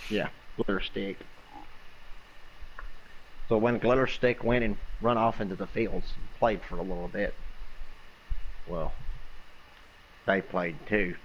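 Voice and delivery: plain reading voice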